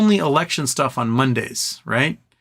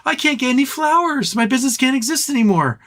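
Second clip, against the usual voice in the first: high, wailing despair over a ruined business; distraught shop owner; 14 semitones higher than usual. Retold or imitated. Flower shop owner tells reporter her business died, high distraught wail. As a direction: wail it like your livelihood just collapsed. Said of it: "She's like".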